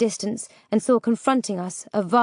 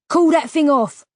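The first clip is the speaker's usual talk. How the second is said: louder, higher sharp command; barked order; 5 semitones higher, 5 dB louder than usual. A narrator's reading. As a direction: order it firmly, voice raised. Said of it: "ordered Lackland".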